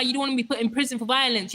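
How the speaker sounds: usual speaking voice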